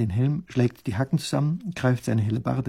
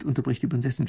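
ordinary delivery

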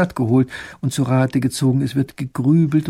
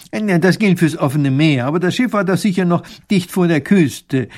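higher, louder, clever objection; objecting professor; 5 semitones higher, 6 dB louder than usual. Acted. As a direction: argue the point keenly. Professor widerspricht. (eifrig widersprechender Professor)

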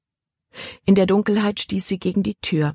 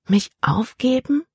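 fassungslos; 9 semitones higher than usual. A narrator's reading. Libby hört es ungläubig.

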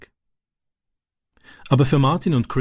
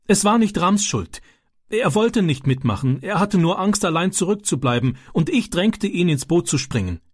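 put-on pleading boy; 8 semitones higher than usual. A narrator's reading. Francis pleads for Ram.

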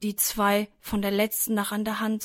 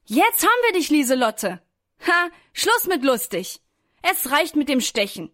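high, raised gloating voice; triumphant; 5 semitones higher, 4 dB louder than usual. A narrator's reading. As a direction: crow over the captured pest. Children triumph over trapped mosquito, acted.

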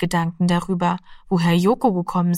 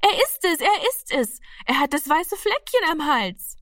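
much higher, thrilled shout of recognition; excited cry; 9 semitones higher than usual. A narrator's reading. Martina recognizing her cat, excited high cry. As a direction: burst out with joy. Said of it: "schrie aufgeregt".

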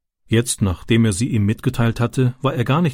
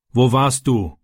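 a touch of irritated stepfather; slower than usual. A narrator's reading. Stepfather catching Francis by the car, mildly irritated. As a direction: snap the question, lightly voiced. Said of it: "fragte sein Vater gereizt".

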